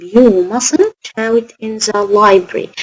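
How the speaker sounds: very slow, every word separate; slow dictation. Shown as a demonstration. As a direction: dictate the model answer clearly. Teacher dictating example sentence.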